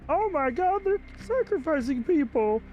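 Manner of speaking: very high shrieking panic; horrified scream. Acted. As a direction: shriek in horror, stretching it. Voicing horrified character.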